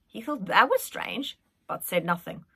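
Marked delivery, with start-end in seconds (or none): none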